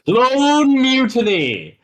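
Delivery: slow, high, loud title call; dramatic announcer. Acted. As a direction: boom it out like a show intro. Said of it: "going more with the fish broadcaster".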